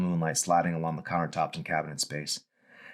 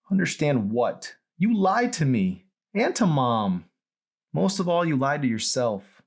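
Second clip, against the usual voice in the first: accusing daughter; 9 semitones higher, 4 dB louder than usual. Acted. Daughter accusing her father of lying, high and loud. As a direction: spell out every accusation.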